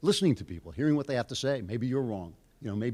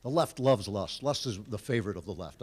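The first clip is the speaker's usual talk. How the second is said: ordinary talk, nothing marked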